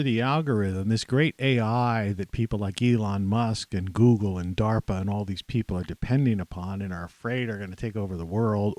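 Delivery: ordinary talk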